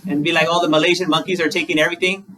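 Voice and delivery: ordinary talk, nothing marked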